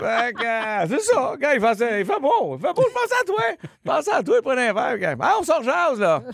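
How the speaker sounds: voix aiguë et traînante d'un ami; message vocal de chum. Retold or imitated. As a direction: imiter un ami qui laisse un message vide. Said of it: "puis que ton message, c'est". (voix aiguë de chum au répondeur)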